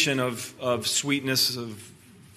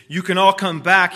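calling out; 6 semitones higher, 8 dB louder than usual. A narrator's reading. Aslan calls everyone back.

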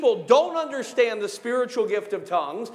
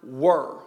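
"were" set apart, dropped lower; stress on "were"; 8 semitones lower than usual. Shown as a demonstration. Preacher pointing out one word, low emphatic "were". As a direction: say "were" alone, low and weighty. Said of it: "the emphasis on the word".

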